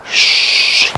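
whispered shush; hushing whisper. Real, not acted. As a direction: hush him so the pig stays. Hushing while hunting pig.

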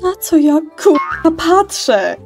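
wysoki, głośny krzyk oburzenia; oburzenie. Acted. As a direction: yell it high in shocked outrage. Shocked character demands an explanation. (wzburzony, piskliwy krzyk)

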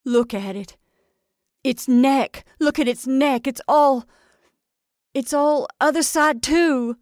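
high, loud, urgent cries; crying out in horror. A narrator's reading. Farm wife shows her friend the bird's neck, crying out. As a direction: raise pitch and volume as alarm mounts. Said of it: "cried Missus Hale".

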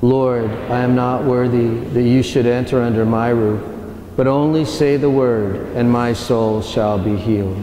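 ordinary talk, nothing marked

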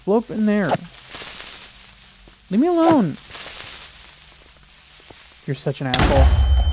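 frantic yell at the creeper; yelling at a mob. Real, not acted. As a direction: shout the creeper away in real alarm.

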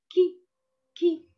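short "كي", a quick "ki"; kasra sound. Shown as a demonstration. Teacher shows pupils kaf with kasra, short ki syllable. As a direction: say "كي" briefly, without stretching the vowel. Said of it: "ينطق".

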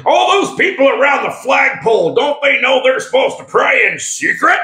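high-pitched mock outrage from a critic; indignant objectors. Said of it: "Some people will read these and then".

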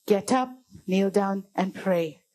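low, slow, measured command; calm divine call. Retold or imitated. God's voice calling a man in bed, low and calm. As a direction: say it low and unhurried, each step separate. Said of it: "the louder the voice got".

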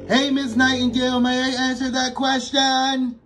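high, booming classroom shout; loud eager shout to the teacher. Acted. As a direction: blast it across the room. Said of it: "Ruby was loud".

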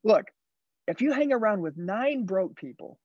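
a coach rallying his car salesmen, punching the key words; motivational sales pep talk. Acted. Sales coach urging the team to dream bigger, driving and emphatic.

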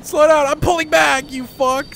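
high, loud exasperated command; panicked yell. Real, not acted. Panicking at speeding game vehicle.

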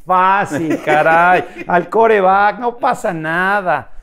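put-on retelling his young self's cocky boast about golf, swaggering. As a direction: brag that it's nothing. Boasting golf will be easy.

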